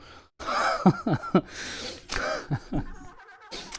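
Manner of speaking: breathy burst of real laughter; long amused laugh. Real, not acted. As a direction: crack up at your own joke. Cracks up at own shouted no.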